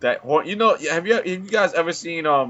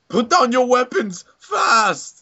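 high, slow hammed-up Arnold accent; mock Schwarzenegger; 9 semitones higher, 4 dB louder, slower than usual. Friend retelling an Arnold parody, in mock Arnold voice. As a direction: bark the order like an action hero. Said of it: "he says".

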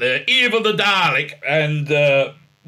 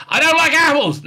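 mock snappy refusal; 10 semitones higher, 5 dB louder than usual. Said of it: "you got to say".

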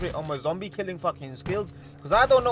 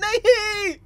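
high sharp no; frustrated cry; 9 dB louder, much slower than usual. Real, not acted. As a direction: cry out in dismay. Nearly had him. (झुंझलाकर चीख)

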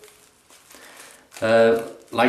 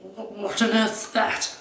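much higher, slow, appalled posh snob; shaving snob; 11 semitones higher than usual. Retold or imitated. Snob recoiling at cheap shaving soap, hammed-up disgust. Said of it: "the shaving snob".

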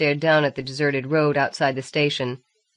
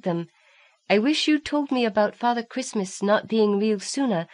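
put-on little girl's letter; 6 semitones higher than usual. A narrator's reading. Rowan writing to her mummy and daddy, childlike and earnest. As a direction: read it as the small girl who wrote it.

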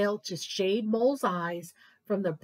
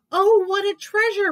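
high, loud joyful shout; 9 semitones higher, 8 dB louder than usual. Acted. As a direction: shout it out in delight. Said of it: "Mole shouted".

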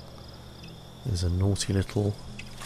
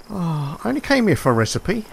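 exasperated; 7 semitones higher than usual. Real, not acted. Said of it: "repeated in a flatter voice".

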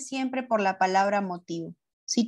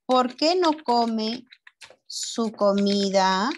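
slow question, split before "su comida"; slow dictation; much slower than usual. Shown as a demonstration. Teacher writes out a pupil's question, slow dictation. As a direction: dictate in pieces, rising at each end.

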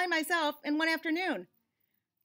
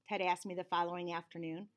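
usual speaking voice

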